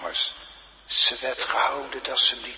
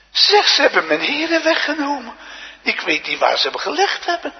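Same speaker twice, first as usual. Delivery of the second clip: loud, high cry from the pulpit; impassioned preaching; 12 semitones higher, 9 dB louder than usual. Acted. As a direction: preach Mary's words out forcefully. (luide, gedreven preektoon)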